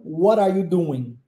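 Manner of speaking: what are you doing with linked what are and doin; model phrase to repeat. Shown as a demonstration. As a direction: say it for the student to copy. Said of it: "Vamos tentar?".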